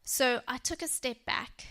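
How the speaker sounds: usual speaking voice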